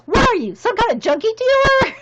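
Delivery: high, loud comic indignation; mock outrage. Gym teacher refused another Midol, mock outraged. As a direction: act out an indignant accusation at full voice. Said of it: "He goes".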